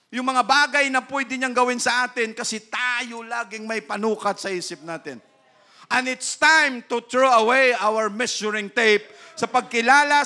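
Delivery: loud, high pulpit voice; fiery preaching. Acted. Preacher rousing the brethren, loud and high. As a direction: drive the point home to the congregation.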